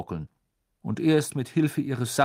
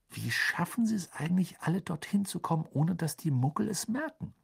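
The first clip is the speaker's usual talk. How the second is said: lightly voiced boy, higher pitch; curious boy; 6 semitones higher than usual. Harry asks Mr Weasley about Muggles, lightly voiced curious boy.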